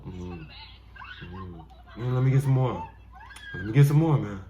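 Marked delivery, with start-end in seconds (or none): 2.0-4.4 loud greedy animal voice; voicing an animal. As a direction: beg for food like the animal. Voicing animal wanting food.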